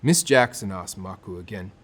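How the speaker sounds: ordinary delivery, nothing marked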